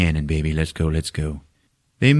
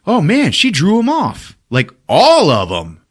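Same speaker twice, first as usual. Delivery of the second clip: high, impressed exclamation; Poe's mock amazement; 8 semitones higher, 4 dB louder than usual. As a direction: marvel at it, drawing out the end. Poe amazed at the diversion.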